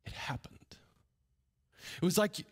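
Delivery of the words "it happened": hushed. A narrator's reading. Dramatic hushed reveal.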